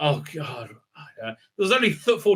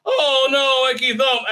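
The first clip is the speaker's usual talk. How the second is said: high, loud hammed-up cry; mock dismay; 9 semitones higher, 6 dB louder than usual. Heroine crying out at the creatures, mock dismay. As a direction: overplay the flustered exclamation. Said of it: "mocking character's exclamation".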